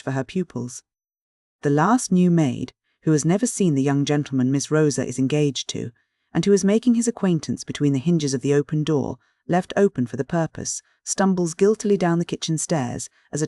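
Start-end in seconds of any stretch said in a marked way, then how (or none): none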